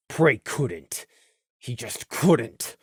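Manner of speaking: soft, low, pained narration; hushed. A narrator's reading. Narrator on Prey unable to face it, hushed. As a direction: drop low and soft, slowing down. Narrator voices Prey's inner struggle.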